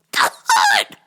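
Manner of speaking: very high mock shriek. Shrieking at dad.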